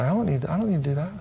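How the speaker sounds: rapid, soft dismissal of the tempter; quick refusal. Shown as a demonstration. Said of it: "Just say".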